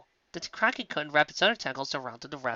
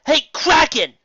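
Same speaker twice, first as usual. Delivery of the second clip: high, loud call across the water; loud defiant hail; 13 semitones higher, 13 dB louder, slower than usual. A narrator's reading. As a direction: shout to get the monster's attention. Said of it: "she called".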